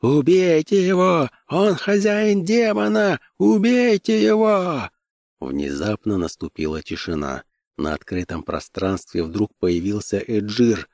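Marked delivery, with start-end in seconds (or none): 0.0-4.9 high, drawn-out mob shouting; angry crowd cries. A narrator's reading. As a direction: call out like an enraged crowd, pitched way up. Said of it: "В поднявшемся рёве замешательства Конан услышал". (протяжные гневные выкрики толпы)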